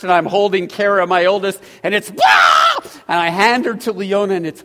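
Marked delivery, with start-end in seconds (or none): 2.2-2.8 very high, loud mock newborn wail; imitated baby scream. Father retelling the delivery room, loud mock baby shriek. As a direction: shriek it like a newborn handed over. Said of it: "was screaming like".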